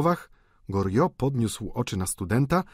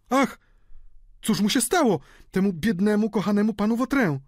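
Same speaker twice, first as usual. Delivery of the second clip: very high, louder fretful woman's voice; przejęta kobieta; 12 semitones higher, 4 dB louder than usual. A narrator's reading. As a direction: wail in alarm over the poor man. Kobieta przerażona upadkiem Vautrina. (wysoki, przejęty lament)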